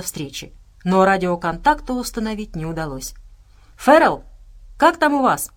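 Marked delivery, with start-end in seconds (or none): 3.8-5.5 raised, higher calling voice; urgent radio hail; calling over the radio. A narrator's reading. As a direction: call out as if trying to raise someone by radio. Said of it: "повторял и повторял Джексон в микрофон рации".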